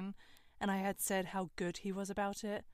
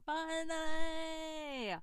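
drawn out; 8 semitones higher, 4 dB louder, much slower than usual. Real, not acted. Drawn-out triumphant word.

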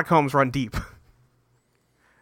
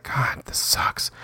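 a slow whispered moan of boredom; whispered grumble; 6 dB quieter, slower than usual. Acted. Spies grumbling while watching.

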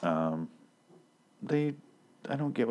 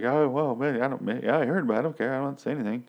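lightly voiced nervous stammer, higher pitched; stammering Eugene; 6 semitones higher than usual. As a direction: fumble the words like a cornered nerd. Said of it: "stammers and is like".

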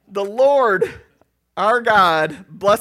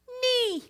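tiny high-pitched squeak of a nee; thin high nose-whistle nee; high whistling nee. Retold or imitated. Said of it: "all went".

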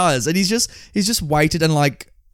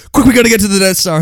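fast, louder and higher mock line; rushed line reading; 7 semitones higher, 6 dB louder, faster than usual. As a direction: race through it like a table read. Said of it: "saying their lines really quickly".

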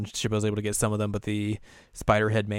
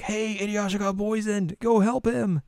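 high, urgent plea; high urgent kid; 11 semitones higher than usual. Retold or imitated. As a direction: rush them to help. Voicing Shippo urging.

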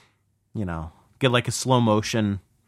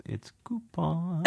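small, high, drawn-out mock voice; a soft, slow sing-song correction from the little voice inside; inner nag; 7 semitones higher, 9 dB quieter, slower than usual. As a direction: play a tiny nagging inner voice. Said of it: "little part of me that goes".

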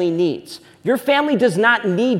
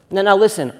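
normal talking voice

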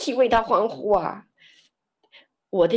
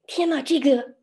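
amazed; 10 semitones higher, 5 dB louder than usual. Retold or imitated. Amazed inner thought, laughing after.

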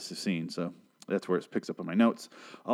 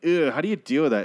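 mock city snob; 6 semitones higher than usual. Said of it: "city people ... they're like".

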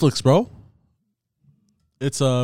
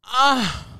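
disgusted gasp; 12 semitones higher than usual. Real, not acted. Reacts to smell.

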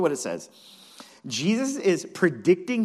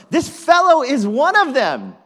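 high, shouted finger-pointing; acted shout of accusation; loud accusation; 13 semitones higher, 15 dB louder than usual. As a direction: call him out to everyone around. Servant girl accuses Peter to bystanders.

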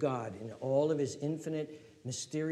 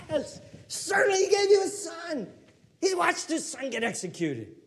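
loud, high call-and-response preaching; fiery pulpit call and answer; preaching at full force; 13 semitones higher, 7 dB louder than usual. Acted. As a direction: drive each question home to the room.

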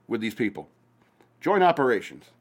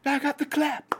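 high squeaky take on I got the clap; much higher comic repeat; high silly voice. Shown as a demonstration. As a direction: squeal it like a cartoon character.

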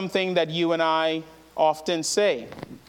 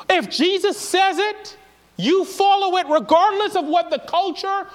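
much higher, louder impassioned preaching; preaching at full force; 6 dB louder than usual. Real, not acted. Preacher urging believers to follow Jesus, preaching at full force. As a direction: build to a high, loud pulpit shout.

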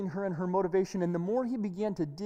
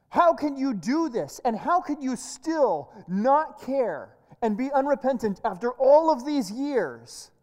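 loud, higher-pitched outraged demand; furious confrontation; 4 semitones higher, 7 dB louder than usual. Acted. Reporter confronts the person who wronged her, furious. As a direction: lay into them angrily, voice raised. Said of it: "he's just furious and laying into them".

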